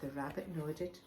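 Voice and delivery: plain reading voice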